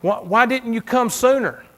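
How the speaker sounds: high, loud preacher's cry; preaching at full force. Acted. Pastor to congregation, preaching loud and high. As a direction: lift the church with the story. Preaching builds to sisters' plea.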